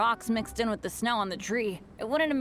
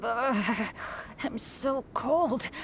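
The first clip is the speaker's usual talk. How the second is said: ordinary talk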